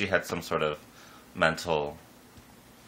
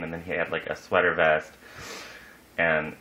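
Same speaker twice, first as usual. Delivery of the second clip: ordinary delivery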